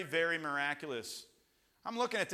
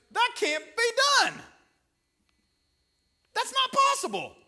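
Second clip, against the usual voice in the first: loud, high-pitched disbelief; preacher's voice leaping up in amazement; incredulous exclamation; 8 dB louder than usual. Real, not acted. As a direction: cry out at the impossible. Exclaiming at an impossible miracle.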